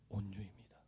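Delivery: usual speaking voice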